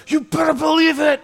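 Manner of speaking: high, put-on indignant voice; mock angry retort. Preacher as Jonah answering God back, high mock anger. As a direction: fire back in a high, huffy voice. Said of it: "he gets so angry".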